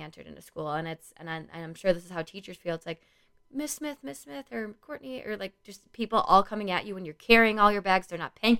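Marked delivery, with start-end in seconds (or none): 3.5-4.5 high, drawn-out imitation of kids calling; pestering kids. Kids coming at teacher arriving with bags, mock pestering. 4.8-5.2 high, soft imitation of a calling child; pestering kid. Kids calling out as she enters school, mock pestering.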